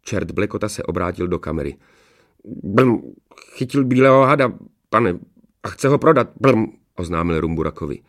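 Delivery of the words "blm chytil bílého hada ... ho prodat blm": loud, burbling devil voice; devil servant. A narrator's reading. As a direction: report to the master, gurgling between phrases. Devil Blekota reports with burbling noises.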